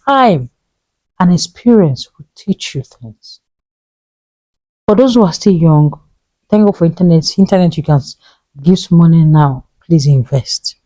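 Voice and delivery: ordinary talk, nothing marked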